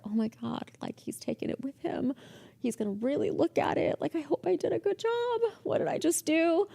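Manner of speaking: high, hushed panic; sweating bullets. Retold or imitated. Receptionist alone after boss takes her work, panicking. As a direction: fret to yourself, voice climbing. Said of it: "sweating bullets".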